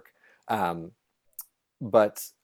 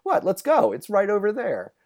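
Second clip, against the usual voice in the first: higher, airy mock of a far-off local; breezy Albertan local; 9 semitones higher, 4 dB louder than usual. Imitating a rural Albertan shrugging off a long drive, higher and breezy. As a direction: wave off fifty miles as nothing, lightly mocking. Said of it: "who's kinda like".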